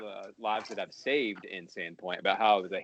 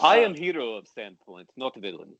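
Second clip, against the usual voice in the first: put-on Russian accent; 8 dB louder than usual. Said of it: "in the Russian accent".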